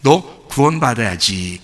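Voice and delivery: low, soft and slow; tender father. Retold or imitated. Father tells elder son softly he needs saving. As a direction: tell him gently he must be saved.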